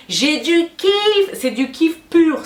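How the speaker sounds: high, drawn-out burst of enthusiasm; gushing. Real, not acted. YouTuber telling fans why she keeps going, gushing. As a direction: stretch each kiff out high, elated.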